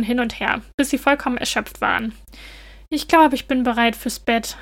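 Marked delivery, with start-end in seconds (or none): none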